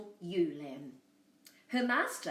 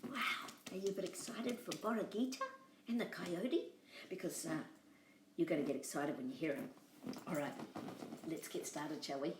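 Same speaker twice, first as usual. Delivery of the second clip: hushed aside; 2 semitones lower, 6 dB quieter, faster than usual. Real, not acted. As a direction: lean in and hush them down. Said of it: "quiet aside before story".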